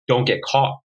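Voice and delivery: usual speaking voice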